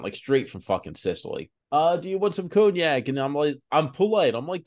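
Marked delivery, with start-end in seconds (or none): 1.7-3.1 mock thick Sicilian Italian accent, pitched higher; light hammed-up Italian accent offering a drink. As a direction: imitate an old Italian grandfather's Italian accent. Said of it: "he's a thick Italian accent".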